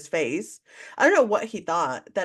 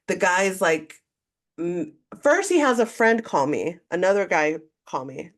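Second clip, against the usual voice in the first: ordinary talk, nothing marked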